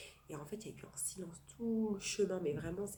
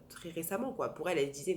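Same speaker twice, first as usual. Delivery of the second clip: ordinary delivery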